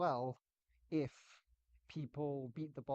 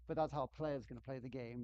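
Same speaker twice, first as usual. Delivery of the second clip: usual speaking voice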